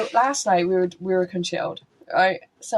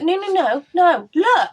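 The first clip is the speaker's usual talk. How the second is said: eager insist; 9 semitones higher, 7 dB louder, slower than usual. Retold or imitated. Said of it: "I was like".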